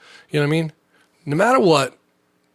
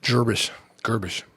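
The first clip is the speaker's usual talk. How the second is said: gibberish accent; 3 semitones lower than usual. Shown as a demonstration. Showing the mixed accent he used, light gibberish. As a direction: babble nonsense words in fake accent. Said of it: "mix everything up".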